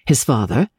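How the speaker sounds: plain reading voice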